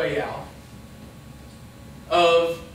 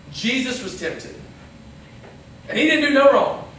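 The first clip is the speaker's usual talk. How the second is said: ordinary delivery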